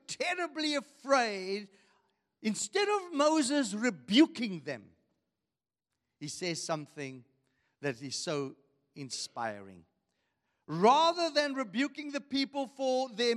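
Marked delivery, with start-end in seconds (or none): none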